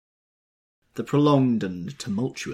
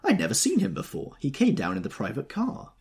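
high, louder protest; denying; 11 semitones higher, 4 dB louder than usual. A narrator's reading. Daisy disowning the wedding guest, denying. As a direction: protest it in a raised pitch. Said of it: "she denied".

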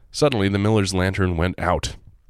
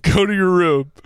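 high, loud, stern telling-off; a hammed-up parent scolding a monster; mock scolding parent; 12 semitones higher, 5 dB louder than usual. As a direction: send the monster to its room like a naughty kid. Repeats scolding.

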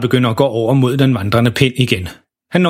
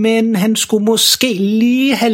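langtrukken, langsom cliffhanger; træk sætningen ud og lad faren ane; ildevarslende fortæller; 9 semitones higher, much slower than usual. A narrator's reading. Fortælleren varsler en ulykke. (langsomt og varslende)

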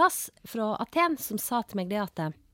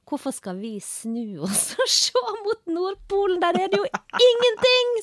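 quoted Greek man, much higher and louder with laughter; laughing retort; 12 semitones higher, 7 dB louder than usual. Retold or imitated. Greek man teasing about the North Pole, loud laughing retort. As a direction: toss the punchline out high and laughing. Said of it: "sa han da".

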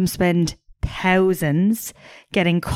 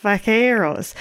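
put-on Spanish accent; 4 semitones higher, slower than usual. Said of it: "Spanish accent".

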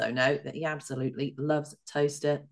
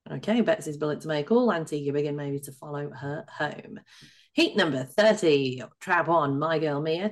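ordinary delivery

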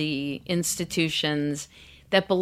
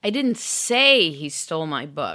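"say" punched; "say" stressed, rest even. Shown as a demonstration. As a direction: hit the word "say". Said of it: "stress the subsequent word".

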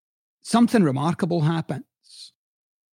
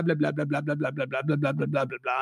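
dismissive blah blah; 6 dB quieter than usual. Retold or imitated. Mocking a client's endless backstory.

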